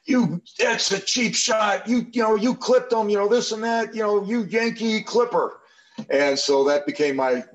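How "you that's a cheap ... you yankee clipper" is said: hammed-up Southern teammate scolding a rookie; imitating Southern teammates. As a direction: jeer it like a riled-up Southern lineman. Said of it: "good old Southern boys".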